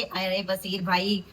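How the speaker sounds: ordinary talk, nothing marked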